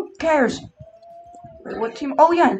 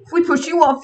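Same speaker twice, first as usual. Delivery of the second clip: usual speaking voice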